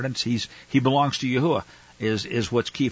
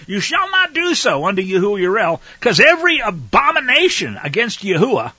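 1 forceful preaching; 6 semitones higher, 6 dB louder than usual. Acted.

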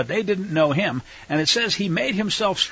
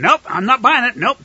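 loud, high mock refusal; acted dismissal; 8 semitones higher, 8 dB louder than usual. Joseph rejects his brothers' story, acted loud dismissal. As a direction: brush it off with a raised voice.

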